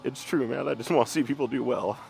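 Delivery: fighting back tears, laughing through them; crying. Real, not acted. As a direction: voice breaking while crying, catching breath. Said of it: "I'm crying".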